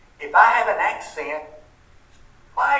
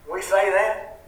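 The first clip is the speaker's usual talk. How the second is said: normal talking voice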